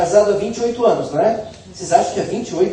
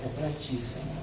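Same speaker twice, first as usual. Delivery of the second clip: soft, lower murmured prayer to the Lord; quiet prayer; 5 semitones lower, 7 dB quieter than usual. Retold or imitated. Preacher quietly praying to the Lord, low and humble. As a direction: pray it under your breath, humbly. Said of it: "Eu fico só falando".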